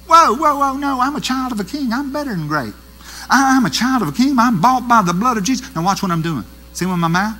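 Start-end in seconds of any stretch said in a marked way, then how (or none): 0.1-5.7 high, bold proclaiming of faith; triumphant declaration. Acted. Preacher acting a confident believer, high triumphant declaration. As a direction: declare it like a child of a king.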